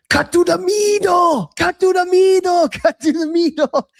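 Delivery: put-on shouted Russian coach accent. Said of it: "in his big Russian accent".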